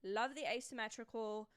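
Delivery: wobbly put-on British accent; mock British, carefully enunciated. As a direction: review it like an amateur British critic. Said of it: "I can't stick to a solid British accent".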